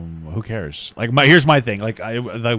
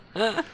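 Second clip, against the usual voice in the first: short laugh; 7 semitones higher, 8 dB quieter than usual. Real, not acted. Laughing at own mock.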